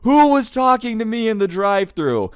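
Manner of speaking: high, loud, furious demand; mock-screaming across a restaurant; imitated scream. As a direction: yell it as the enraged customer storming in. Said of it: "walks in and just screams".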